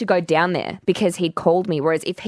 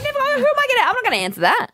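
mock high refusal; faster than usual. Mock refusal to answer phone.

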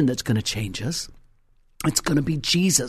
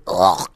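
low guttural "ugh"; disgusted groan; 9 semitones lower, 4 dB louder than usual. Acted. Disgust at unfiltered cigarettes.

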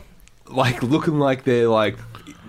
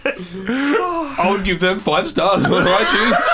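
slow, laughing, higher; 6 semitones higher, much slower than usual. Pretend. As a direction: laugh it out slowly. Comic bit, laughing.

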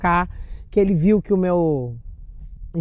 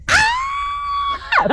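piercing high squeal; imitating a fan's excited squeal; fan shriek. Fan squeals on seeing her.

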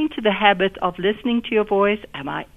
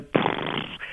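loose lip trill blown on breath; horse lips; 7 dB quieter than usual. Shown as a demonstration. Voice teacher showing a lip-relaxing exercise, horse-lips blow. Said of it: "we do horse lips exercises".

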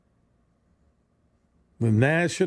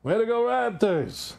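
mock cheer; 8 semitones higher than usual. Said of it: "he said the same thing".

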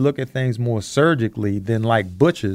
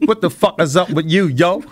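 street greeting voice; 6 semitones higher than usual. Retold or imitated. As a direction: toss it out like a friendly homie. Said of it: "If they said it like that".

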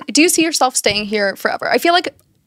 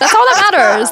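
laughing; 8 semitones higher, 3 dB louder than usual. Real, not acted. Repeats laughing.

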